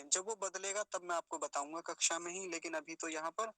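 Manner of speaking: ordinary delivery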